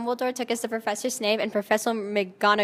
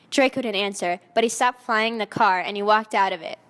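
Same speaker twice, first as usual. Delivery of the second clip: plain reading voice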